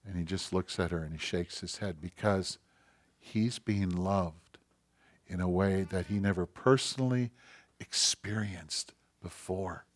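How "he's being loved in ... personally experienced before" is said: ordinary talk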